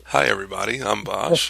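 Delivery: lively host kicking off a retake; excited intro. Pretend. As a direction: put some punch into the show opener. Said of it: "we gotta do the excited intro".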